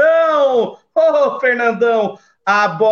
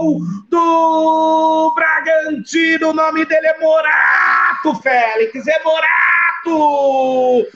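explosive goal commentary; 4 semitones higher than usual. Acted. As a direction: shout the goal, draw it out. Goal scored, shouted call.

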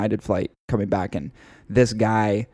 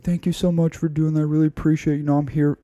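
put-on higher, thankful old man's voice; grateful old man; 6 semitones higher, faster than usual. Old man thanking his defender, higher grateful voice. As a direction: lift it up high in frail gratitude. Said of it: "he's like".

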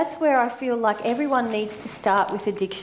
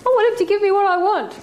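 mock demanding whine; 9 semitones higher, 4 dB louder, faster than usual. Voicing the demanding inner child.

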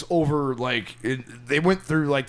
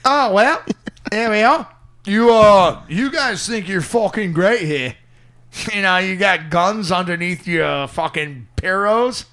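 loud, higher put-on Aussie voice; mock Australian accent; 3 semitones higher, 4 dB louder than usual. Friend mocking Jim Jeffries in a loud Australian accent. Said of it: "He's like the Chinese Englishman".

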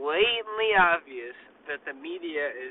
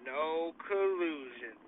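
much softer, slow, under the breath; quiet aside; 9 dB quieter, slower than usual. Pretend. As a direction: drop it to a murmur. Repeats slogan.

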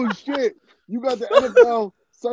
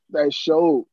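ordinary talk, nothing marked

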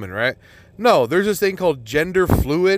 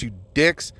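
normal talking voice

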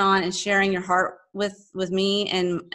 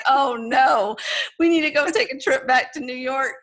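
mock alarmed parents; 6 semitones higher than usual. Parents joking about the Texan accent, hammed-up alarm. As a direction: play the parents as comically alarmed. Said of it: "they were like".

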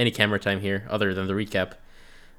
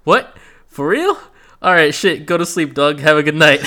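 loud, high surprise then backing off laughing; shocked Kimbo; 6 semitones higher, 7 dB louder than usual. Retold or imitated. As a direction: blurt it out stunned, then laugh it off. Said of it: "And Kimbo goes".

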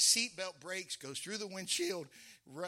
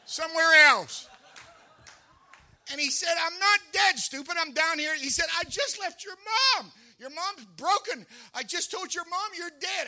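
louder, higher, urgent pleading; excited calling; 9 semitones higher, 10 dB louder than usual. Retold or imitated. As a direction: insist you're alive, fast and loud. Dead man phones friend, excited.

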